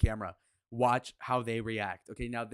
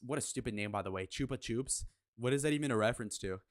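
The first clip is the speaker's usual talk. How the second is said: usual speaking voice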